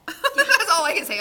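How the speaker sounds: laughing mid-sentence. Real, not acted. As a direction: say it through a laugh. Laughs at herself.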